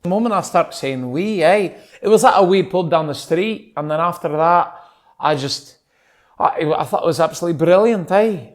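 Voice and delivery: casual demo of a Scottish accent. Shown as a demonstration. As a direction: chat away in a Scottish brogue. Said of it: "then I'm gonna try my accent on".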